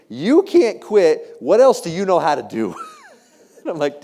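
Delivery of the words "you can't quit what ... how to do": loud, high, drawn-out retold scolding; exasperated spouse. Wife to husband who wants to quit, exasperated. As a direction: imitate a wife putting her foot down, loudly.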